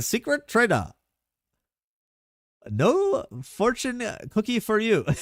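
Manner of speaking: high, slow mock Vietnamese accent. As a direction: imitate a friend's Vietnamese accent. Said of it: "doing his Vietnamese accent".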